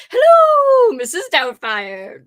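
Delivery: high, loud sing-song greeting; Mrs Doubtfire hello. Retold or imitated. Greeting the chat as Mrs Doubtfire, high and loud. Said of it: "who I am spoofing when I say hello".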